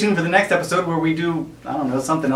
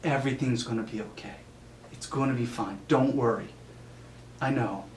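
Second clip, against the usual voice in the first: mock soothing; 7 dB quieter than usual. Soothing on pretend call.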